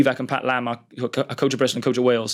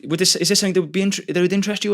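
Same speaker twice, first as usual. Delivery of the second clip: a higher, quicker question in a light imitation; quoting Wayne; 6 semitones higher, faster than usual. Coach phoning the player about Wales, a light, higher imitation. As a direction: lightly mimic the coach's phone offer. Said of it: "And he's like".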